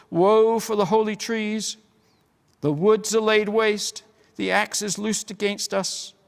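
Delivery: high, loud keening lament, slowing on the falling; lamenting dryad. A narrator's reading. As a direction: mourn the falling trees aloud. Dryad laments the felled trees.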